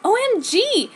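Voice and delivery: high, loud excited squeal. Acted. Jenny squealing at the reunion, high and loud. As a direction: squeal it out with joy. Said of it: "said Jenny".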